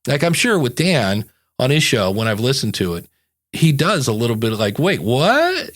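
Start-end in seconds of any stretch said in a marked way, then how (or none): 4.8-5.8 higher, incredulous rising question; mock surprise. Imitating a podcast host hearing new information, surprised. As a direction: act taken aback, swooping up on "what".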